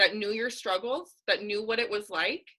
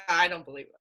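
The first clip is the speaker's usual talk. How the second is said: ordinary delivery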